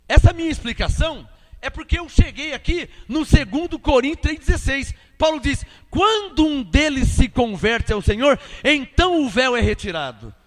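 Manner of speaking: preacher driving the explanation forward; emphatic preaching. Real, not acted. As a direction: proclaim the verse to the congregation. Preaches on the verse.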